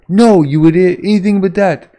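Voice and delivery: high, loud frantic protest; panicked shout. Acted. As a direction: yell to stop a disaster. Acted panicked reply to meteor threat.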